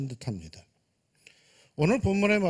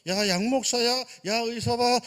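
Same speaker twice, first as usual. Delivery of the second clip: high, loud call as God to the pastor; God calling out to a lamenting pastor, raised and loud; 7 semitones higher, 5 dB louder than usual. Acted. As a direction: call him by name, exasperated. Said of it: "하나님께서 한탄하는 나를 향해서".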